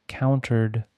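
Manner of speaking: ordinary delivery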